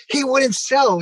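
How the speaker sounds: high, indignant protest; exasperated protest. Retold or imitated. Wrestler protesting to Anthony Rufo, high and indignant. Defending himself to the promoter.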